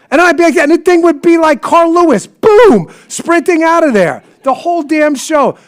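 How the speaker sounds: high and loud, racing through the climax; fly zooming off like Carl Lewis, told with glee; excited storytelling. Real, not acted. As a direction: build the tale to a gleeful peak.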